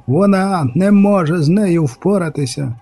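high, slow cry; druid's high-pitched narrated shout; shouting over the din. A narrator's reading. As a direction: call out over roaring noise, stretching the words. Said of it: "крикнув друїд, пересилюючи гули й гуркіт".

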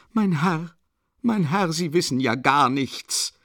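crying out. A narrator's reading. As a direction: cry out in protest at the gentleman. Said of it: "schrie Katharina Iwanowna". (aufgebrachter Aufschrei, hoch beginnend)

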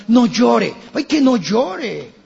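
loud, high mock nagging comfort; mock scolding comfort. Preacher mimicking mourners urging a widow, loud and high. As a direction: nag the mourner to stop crying.

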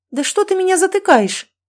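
very high-pitched protest; indignant yell. A narrator's reading. Mother objects to son silencing her, indignant yell. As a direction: object shrilly to being silenced.

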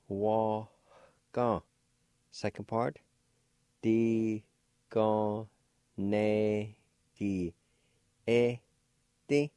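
"Wa, ga" then "Di, ga, ne, gi, e, Ti" said apart; syllable by syllable. Shown as a demonstration. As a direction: spell out each syllable aloud for the learner. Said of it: "like this".

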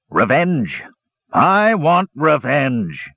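slow, drawn-out villain's threat; threatening. A narrator's reading. Angry warrior demanding revenge in a little shack, slow and threatening. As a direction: stretch out each word menacingly. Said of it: "Gorath's threatening words resounded through the little shack".

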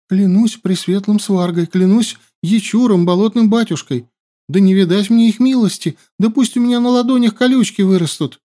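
high, loud, rushing swearing; frantic oath. A narrator's reading. Captive swears desperately. (отчаянная клятва)

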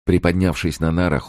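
ordinary delivery, nothing marked

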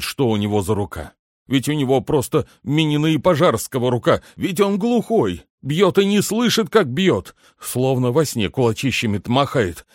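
high, agitated, pleading shoemaker; перепуганный башмачник. Acted. Жалуется дворецкому на глухого обидчика. (взволнованная жалоба высоким голосом)

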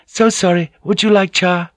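high, cheerful courtesan voice; high girlish politeness. A narrator's reading. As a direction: offer it gaily, pitched high. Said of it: "Kiku called out gaily".

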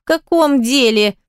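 high whimpering question. A narrator's reading. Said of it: "проскулил Давид".

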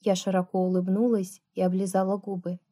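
usual speaking voice

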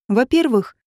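plain reading voice

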